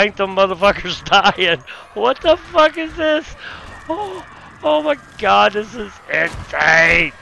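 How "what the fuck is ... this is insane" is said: much higher, slow incredulous cry; stunned disbelief. Real, not acted. Gamer seeing strange monsters, high stunned disbelief. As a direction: caught off guard, voice jumping up. Weird enemy appears suddenly.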